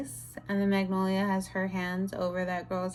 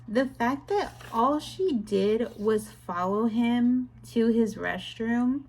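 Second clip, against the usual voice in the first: ordinary delivery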